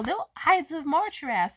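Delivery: ordinary delivery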